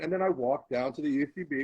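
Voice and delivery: ordinary talk, nothing marked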